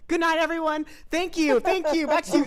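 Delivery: loud, bright fake goodbye; mock sign-off. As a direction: wrap it up like a host.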